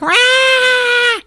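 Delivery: high, loud bug-monster shriek; imitated monster scream; mock monster screech. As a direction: screech like a creature, long and high. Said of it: "And she's like".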